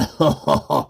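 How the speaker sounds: low chuckle; laugh. Acted. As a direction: laugh at your own joke. Minister laughs at own joke.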